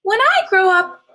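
proud caterpillar. A narrator's reading. Caterpillar boasting to the snail, high proud voice. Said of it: "she told the pollywog".

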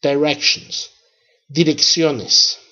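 normal talking voice

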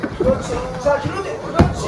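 ordinary talk, nothing marked

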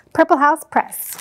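ordinary delivery

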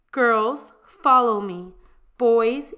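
loud commanding call. A narrator's reading. Nun orders the arriving children, loud and commanding. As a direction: summon the children like a strict nun.

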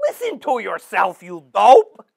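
low, loud jab; shouted insult. Acted. Mentor scolds the host, loud insult. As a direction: bark the insult at him.

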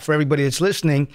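normal talking voice

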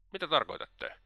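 mildly taken aback, asking what he means; slightly puzzled. Acted. Svidrigailov puzzled by Raskolnikov's insult, mildly surprised. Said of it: "hieman kummastuneena".

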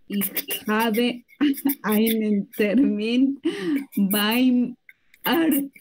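se le escapa la risa a mitad de frase; riendo entre palabras. Real, not acted. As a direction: risa nerviosa al pronunciar. (leyendo entre risas)

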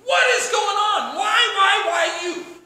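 mock interrogation escalating to a shouted why; accusatory questioning, building. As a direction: pile on the blame until it bursts into shouting. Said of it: "We throw accusations out".

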